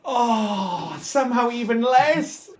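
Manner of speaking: high-pitched wail of disbelief; dismayed cry. Real, not acted. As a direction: groan upward in exasperated dismay. Reroll comes out worse.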